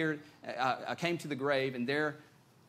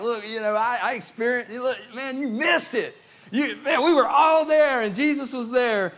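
high, loud, excited crowing; rubbing it in; 7 semitones higher, 10 dB louder than usual. Acted. Disciples telling absent Thomas he missed Jesus, acted excited boasting. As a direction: crow over what he missed, building to a shout. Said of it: "kinda rubbed it in".

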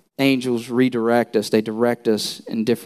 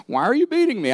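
higher, mock-indignant donkey voice; 11 semitones higher, faster than usual. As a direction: play the wronged donkey, pitched up. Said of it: "he literally said that".

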